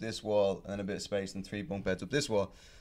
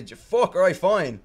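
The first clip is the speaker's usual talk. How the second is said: higher, louder annoyed surrender; grudging give-in; 8 semitones higher, 8 dB louder than usual. Retold or imitated. Pushed out of the bunk, annoyed giving in. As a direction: huff and give up. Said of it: "I'm like".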